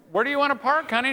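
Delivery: high-pitched comic deference; mock timid husband. Husband frozen at the wheel asking his wife, mock deferential.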